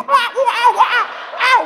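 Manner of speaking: very high-pitched monkey whooping; hammed-up ape calls; imitating monkeys. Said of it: "Kayak monyet gitu".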